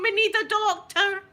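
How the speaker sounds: high, loud wail through laughter; panicked yell. Retold or imitated. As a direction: cry out for a doctor, hammed up. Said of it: "And I'm going".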